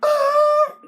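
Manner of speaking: high, loud roaring ah; T-Rex roar. Shown as a demonstration. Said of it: "Add the".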